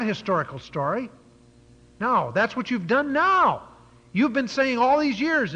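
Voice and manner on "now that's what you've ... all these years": raised, louder preaching voice; high, emphatic pulpit rebuke; forceful accusation. Acted. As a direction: drive the charge home at the listeners. Preacher accusing his listeners.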